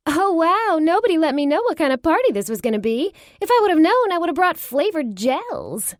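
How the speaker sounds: high, loud, playful mock outrage; teasing character voice. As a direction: ham up the cheeky surprise.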